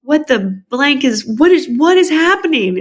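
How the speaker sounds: high, stunned what is happening; imitating sister's alarmed confusion; shocked disbelief. Sister confronting mom about the secret, imitated high shocked disbelief. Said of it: "my sister goes".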